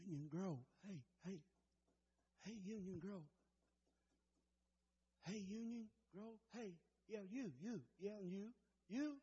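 very soft, low prayerful voice; hushed altar call. Acted. Preacher calling the church to let the Savior in, hushed. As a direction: plead gently, barely voiced, leaving long pauses.

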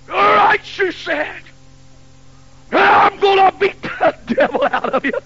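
high, loud threatening shout; a fed-up mother about to whip her son; angry mother. Retold or imitated. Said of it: "something happened to that woman".

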